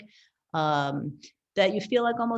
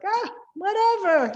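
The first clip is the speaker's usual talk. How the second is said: much higher, louder, careless imitation of mom; mom brushing it off; 13 semitones higher, 5 dB louder than usual. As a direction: toss it off like nothing matters. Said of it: "she was like".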